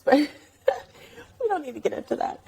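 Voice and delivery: laughing whisper, quick; breathy laugh, then whisper it away; whispered aside. Real, not acted. Aside about speedboat story.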